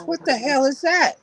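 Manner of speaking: mama's outraged question. Retold or imitated. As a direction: imitate her disbelief at the thin steak. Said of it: "and she goes".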